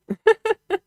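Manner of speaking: sudden high-pitched laugh; high giggle. Real, not acted. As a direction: burst into a squeaky giggle. Laughs about being close to the mic.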